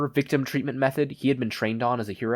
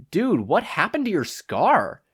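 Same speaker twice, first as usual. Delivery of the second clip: high, slow astonished exclaim; shocked friend exclaiming; 8 semitones higher, 5 dB louder, slower than usual. A narrator's reading. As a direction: blurt it out, amazed. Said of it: "he exclaimed".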